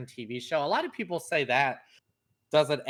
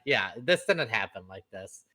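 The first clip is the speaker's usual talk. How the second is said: ordinary talk, nothing marked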